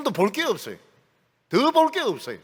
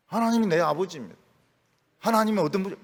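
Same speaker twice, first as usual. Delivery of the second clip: normal talking voice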